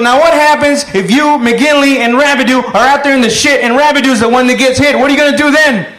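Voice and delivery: high, hectoring interrogation; sergeant's angry challenge. Acted. Sergeant testing a soldier with a hit-buddy scenario, angry challenge. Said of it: "he brings him over and he goes".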